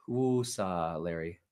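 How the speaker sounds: low, soft self-soothing breath words; quiet woosah to settle the nerves; calming himself down. Real, not acted. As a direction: talk yourself back down, gently. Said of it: "I need to calm down".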